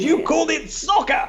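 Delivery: imitating a teasing host; smug interviewer. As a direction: laugh as you catch him out. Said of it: "And he goes".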